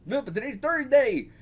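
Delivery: high character voice, objecting; put-on beggar voice. Beggar objecting that it is Thursday, high voice. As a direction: pitch it up as a protesting cartoon beggar.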